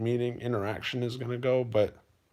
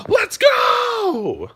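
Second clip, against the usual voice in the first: huge, much higher shouted hype call; 11 dB louder than usual. Shown as a demonstration. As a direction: yell it like firing up the gym floor. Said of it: "Every time I step out on the floor and give".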